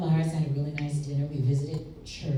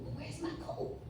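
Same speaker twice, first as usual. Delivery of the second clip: anxious whisper; 13 semitones higher, 14 dB quieter than usual. Retold or imitated.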